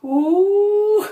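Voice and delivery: high, loud startled ooh; a sudden, loud, high ooh; loud surprised ooh. Real, not acted. Loud high ooh when the dog comes at her.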